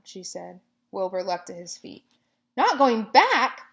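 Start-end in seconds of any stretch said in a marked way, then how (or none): 2.6-3.6 loud, slow, high cry of shock; cried out. A narrator's reading. Pig hears spider won't return, crying out. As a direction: cry it out in disbelief. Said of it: "he cried".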